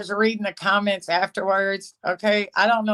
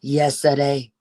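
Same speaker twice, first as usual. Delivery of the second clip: Yesterday said low in a Cajun accent; 6 semitones lower than usual. Shown as a demonstration. Repeating the word Yesterday in Cajun accent. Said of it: "That's my Cajun accent".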